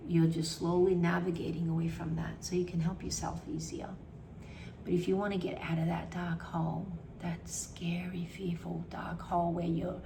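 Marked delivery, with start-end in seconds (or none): 4.8-10.0 hushed whisper about the dark hole. Real, not acted. Whispering to listeners about the dark fear. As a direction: breathe it out, unvoiced and hushed.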